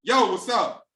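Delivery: casual street greeting, loose and loud; slangy street hello. Retold or imitated. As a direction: answer like you don't care who's calling.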